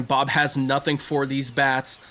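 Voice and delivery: usual speaking voice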